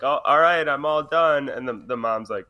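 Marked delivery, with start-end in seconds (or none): 0.0-1.5 lightly voiced cheerful kid; a hint of a kid announcing he's done. Little boy telling his mom he's finished. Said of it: "he's like".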